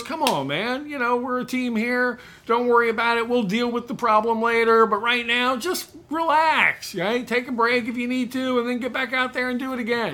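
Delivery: calm him down like a friendly boss; soothing manager. Retold or imitated. Manager soothing upset salesman after the blowup.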